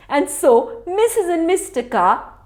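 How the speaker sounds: plain reading voice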